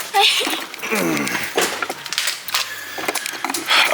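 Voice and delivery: a long, breathy run of laughter; airy laugh trailing off. Real, not acted. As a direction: let the giggle roll out at your own joke. Laughing at his Thai-airplane joke.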